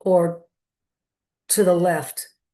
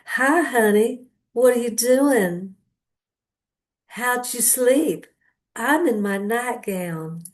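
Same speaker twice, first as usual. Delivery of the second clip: slow, higher, sugary Southern-Texas drawl imitated; sweet Southern drawl; 3 semitones higher, slower than usual. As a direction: coo like a sweet Texas belle on the phone. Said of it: "kinda cross between a Southern and a Texas drawl".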